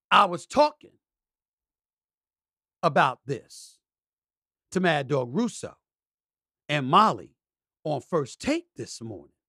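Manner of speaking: ordinary delivery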